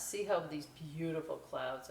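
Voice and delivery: plain reading voice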